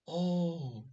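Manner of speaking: high bright "Oh" to open the tease; a playful exclaim up high; mock surprise. To the girl on the floor, teasing surprise.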